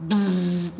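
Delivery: buzzing buzzer noise; wrong answer buzzer. Pretend. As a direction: buzz like a game show buzzer. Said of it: "make a buzzer sound like this".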